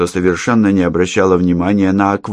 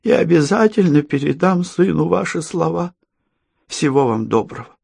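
a hint of an elderly woman; gently voiced grandma; lightly voiced grandmother; 11 semitones higher than usual.

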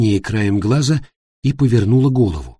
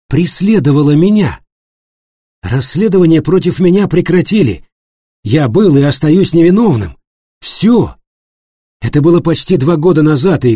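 loud, raised, emphatic protest in short bursts; indignant self-defence; 5 semitones higher, 6 dB louder than usual. Acted. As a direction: insist forcefully and shut the subject down. Florian defends himself and ends discussion. (громко, возмущённо оправдываться, отчеканивая фразы)

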